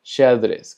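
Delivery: Xadrez said "sha-DREZ" with sh; Portuguese X. Shown as a demonstration. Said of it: "Tenemos xadrez".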